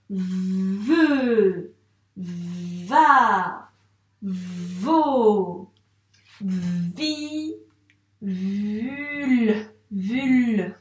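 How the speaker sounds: each of Ve, va, vo, vi, vul with long buzzing v and held vowel; syllable reading demo. Shown as a demonstration. Teacher rereading syllables before the pupil's turn, drawn-out sounds. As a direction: stretch every syllable slowly, separately. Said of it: "je les relis".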